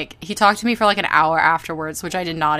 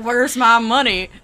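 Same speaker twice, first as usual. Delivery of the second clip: put-on southern accent, laughing; 3 semitones higher, 5 dB louder than usual. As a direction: say "Where's my money?" in a joking southern accent. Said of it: "you doing his southern accent".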